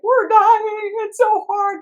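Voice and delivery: high, loud whining complaint; whiny exiles. Retold or imitated. Exiles complaining to the therapist, high and whining. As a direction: whine like a worn-out child part.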